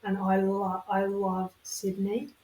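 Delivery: front-of-the-mouth Australian accent. Shown as a demonstration. Demonstrating Australian to friends, Australian accent from Sydney. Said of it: "Australian is at the front of your mouth".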